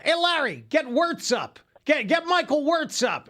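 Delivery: high, no-nonsense dugout command; Lou Piniella. Acted. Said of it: "Piniella turns around and says to Larry Rothschild".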